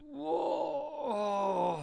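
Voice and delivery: long falling whoa, pitch up high; high surprised whoa. Real, not acted. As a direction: gasp it out in shock. Reacting to handle.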